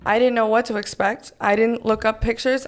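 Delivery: normal talking voice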